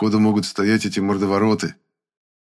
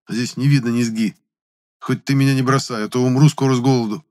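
higher-pitched, quickening plea; plaintive complaint; 5 semitones higher, 3 dB louder than usual. A narrator's reading. Hungry boy begging a ghost to stay, complaining. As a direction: plead with a touch of drama.

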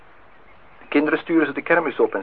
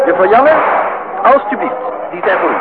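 high, loud and proud presenting; a loud, beaming handover of the prize roses; proud call; 9 semitones higher, 7 dB louder than usual. Acted. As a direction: announce the gift with flourish.

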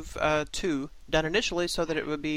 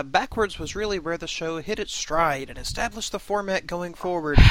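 ordinary talk, nothing marked

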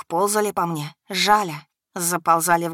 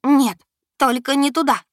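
panicked plea; 8 semitones higher, 6 dB louder than usual. A narrator's reading. Panicked refusal continues.

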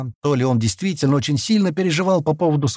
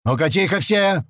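mild yell for silence, pitched up; sudden yell; 6 semitones higher than usual. A narrator's reading. Big man turning on the crowd, understated yell. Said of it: "внезапно заорал здоровяк".

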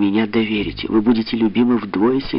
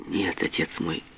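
soft, gentle plea; heartfelt tenderness; 9 dB quieter than usual. A narrator's reading. Daughter reassures her angry father, with heartfelt tenderness. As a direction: say it quietly, with heartfelt affection. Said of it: "с задушевной лаской сказала она".

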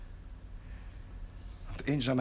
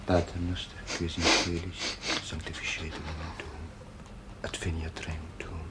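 low prayer fading to a whisper; murmured prayer; 9 semitones lower, 3 dB quieter than usual. Acted. Priest praying the Our Father by the bed, fading to a whisper. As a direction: pray it, sinking into a whisper.

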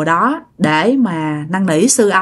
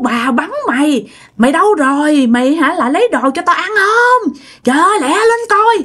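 high, rising to a higher shout at the end; possessed cursing; 6 semitones higher than usual. Retold or imitated. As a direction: hurry them up furiously. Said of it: "chửi um sùm trời đất". (giọng bà bị nhập quát, cao dần)

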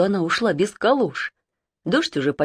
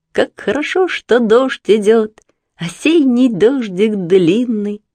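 slow, lilting, higher poem reading; poem recited; 5 semitones higher, 4 dB louder, slower than usual. Acted. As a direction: recite the lines slowly, singing them. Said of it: "прозвучали в ушах у Марины какие-то строчки".

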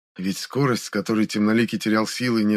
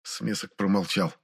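ordinary delivery

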